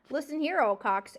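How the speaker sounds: loud sharp snap; snapping. A narrator's reading. Porcupine snapping at the owl, loud. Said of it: "Aerith snapped".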